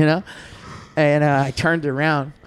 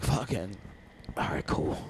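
whispered, much softer; muttered relief; 6 semitones lower, 16 dB quieter than usual. Retold or imitated. Relieved nobody noticed, whispered aside. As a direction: mutter it under your breath. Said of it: "I was like".

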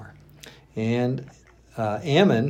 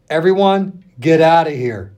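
loud, high order to clear the room; sharp command; 5 semitones higher, 8 dB louder than usual. A narrator's reading. Amnon ordering his servants out, loud command. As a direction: bark them all out of the room.

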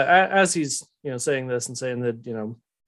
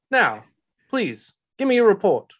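put-on Southern-accented boss asking firmly; pleading order in a Southern accent; Telamon; 6 semitones higher, 4 dB louder than usual. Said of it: "Southern accent".

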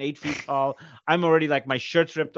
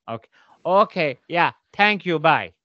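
imitating mom; 3 semitones higher, 4 dB louder than usual. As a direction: mimic mom wrapping up a call. Mom signing off the call.

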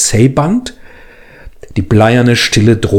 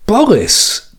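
Boris hoch und überrascht ausgerufen; 10 semitones higher than usual. Acted. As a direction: ruf Boris erstaunt hoch aus. Name laut überrascht aufgenommen. (Boris erstaunt hoch betont)

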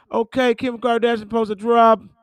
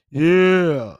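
ordinary delivery

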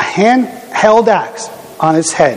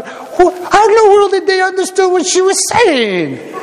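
much higher, baffled question; amazed disbelief; 14 semitones higher than usual. Retold or imitated. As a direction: sound utterly amazed. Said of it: "And I'm going".

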